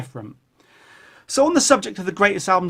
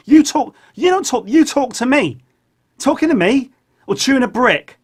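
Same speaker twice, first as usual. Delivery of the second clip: scolding; 7 semitones higher, 5 dB louder than usual. Acted. Scolding the puppet.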